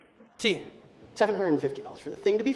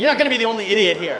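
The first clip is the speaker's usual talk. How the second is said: ordinary talk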